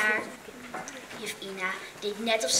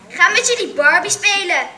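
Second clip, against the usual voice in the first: high, loud taunt; jeering call; 8 semitones higher, 10 dB louder than usual. A narrator's reading. Arno calling out to mock the girls, high and loud. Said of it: "riep Arno".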